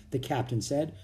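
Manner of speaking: usual speaking voice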